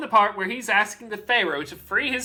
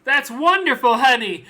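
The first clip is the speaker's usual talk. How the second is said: higher, louder praise; delighted father; 4 semitones higher, 6 dB louder than usual. Acted. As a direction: gush with fatherly pride. Proud father praising his daughter.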